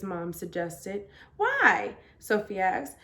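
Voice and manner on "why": high, louder indignant question; indignant why. Acted. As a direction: challenge mom. Child challenges mom's suggestion.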